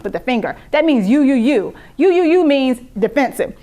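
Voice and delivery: ordinary talk, nothing marked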